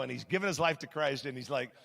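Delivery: ordinary talk, nothing marked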